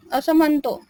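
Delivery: usual speaking voice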